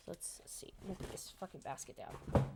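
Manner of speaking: whispered aside. Real, not acted. Quiet aside while unboxing.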